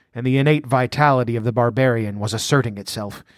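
usual speaking voice

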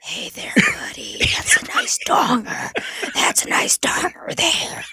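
mocking whisper of a sleazy hillbilly, slow; copying a co-host's hillbilly ASMR whisper, slow; imitating hillbilly ASMR. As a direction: tease a friend by copying his whisper. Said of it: "I like how you're like".

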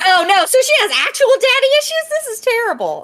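high, fast shocked squeal; real dismay, pitched high and quick. Real, not acted. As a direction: jump up in alarm at the news. Shocked at real daddy issues.

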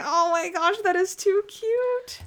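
high, slow gushing coo over something adorable; high cooing "too cute" gush; gushing squeal. Retold or imitated. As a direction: squeal it like you just saw a puppy. Gushing over a sweet scene.

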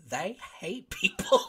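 high, slow, put-on voice for the punchline; mock grim. Telling friends chefs hate people, in a slow mock-grim voice. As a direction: drag out the joke in a mock-dramatic voice.

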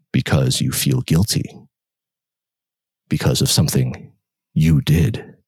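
a hint of a sinister inner voice; low accusing voice. Inner voice blaming the narrator, low and cold.

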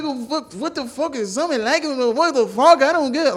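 high-pitched sing-song Irish imitation; mock Irish accent. Comic mimicking a fighter's confusion, high mock Irish accent. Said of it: "He was like".

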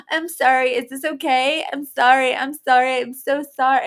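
gasp, then a mock-panicky string of apologies; pleading, hammed-up over-apology; anxious apologizing. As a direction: imitate yourself apologizing compulsively, voice lifted. Acting out her own over-apologizing.

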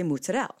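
mozzarella as moot-sa-dell with the final vowel dropped; New Jersey mozzarella. Shown as a demonstration. Repeating the family word mozzarella for viewers. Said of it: "we say mozzarella".

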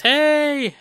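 high, loud call into the distance; shouting out. Retold or imitated. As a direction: yell it out across a long distance. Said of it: "he screams out into the distance".

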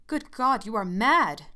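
loud, shocked outcry; aghast. Acted. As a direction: recoil in horror as you cry out. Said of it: "he cried aghast".

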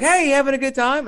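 imitating a chirpy star saying hi; high bright greeting. Quoting Linnea Quigley.

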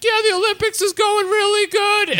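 very high, strained squeal of fake cheer; mock near-sobbing. Skater phoning his mom from the bathroom, mock near-sobbing. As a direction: pretend all is well in a squeaky voice held high. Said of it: "starts, like, sobbing into the phone".

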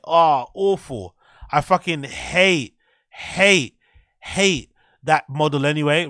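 slow, loud, disgusted groan of hate; disgusted rant. Real, not acted. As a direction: drag out each hate with real disgust. Ranting about hated sneaker model.

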